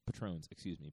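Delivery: ordinary delivery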